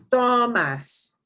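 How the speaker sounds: Tomas with a long, strong TO, then falling; stress on first syllable. Shown as a demonstration. Teacher showing the second to last syllable, first-syllable emphasis on Tomas. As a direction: lean hard on the TO of Tomas. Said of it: "That gets more emphasis".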